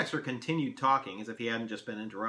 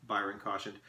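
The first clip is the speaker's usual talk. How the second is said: usual speaking voice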